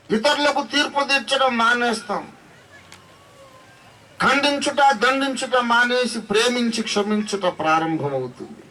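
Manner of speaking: high, ringing sermon climax; fervent preaching. Acted. Preaches at full force.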